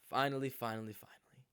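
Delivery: breathless hushed relief; whispered relief. Real, not acted. Waiting in the car, whispering. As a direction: whisper finally under the breath.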